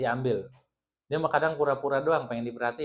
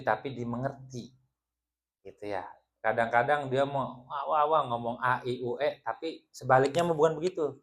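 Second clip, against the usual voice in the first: ordinary talk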